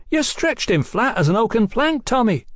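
high, crowing praise; gloating. A narrator's reading. Brother gloating to the young boxer, crowing. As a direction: crow over the knockout. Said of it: "Brian gloated".

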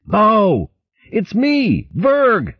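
muffled urgent shout of recognition. Acted. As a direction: cry out to stop his friend. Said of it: "push his head up and shout".

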